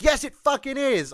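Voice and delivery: high, shouted retort; angry yell. Retold or imitated. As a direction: snap it back loudly. Retold townies yelling.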